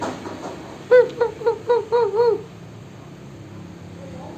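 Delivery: very high squeaky monkey tsz-tsz; monkey chatter. A narrator's reading. Monkeys mocking the peddler, very high chattering. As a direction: chatter back like cheeky monkeys. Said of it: "shook their fingers back at him and said".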